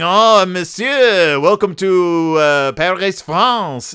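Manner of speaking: slow, drawn-out mock French welcome; exaggerated French accent. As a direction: greet them in a hammed-up French accent. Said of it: "exaggerating my accent".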